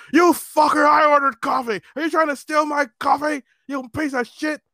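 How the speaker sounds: high-pitched mock shouting, easing in volume; angry drunk customer. Drunk customer accusing the clerk of stealing, high angry rant. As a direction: imitate an irate drunk's high tirade.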